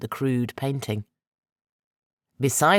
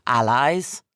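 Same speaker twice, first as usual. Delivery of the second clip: offering alliance; 4 dB louder than usual. A narrator's reading. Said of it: "We will be equals".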